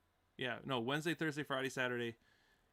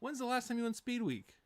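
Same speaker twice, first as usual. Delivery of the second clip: high-pitched needling question; high, mock-doubting banter; teasing challenge; 11 semitones higher, 3 dB quieter than usual. Real, not acted. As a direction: challenge a friend in a raised pitch. Teasing argument over speed week.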